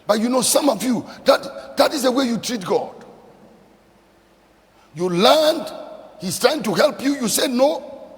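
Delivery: loud, high preacher's rebuke; rising preaching. Acted. Preacher scolding the congregation for refusing God's help, loud and high. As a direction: drive it home at the congregation.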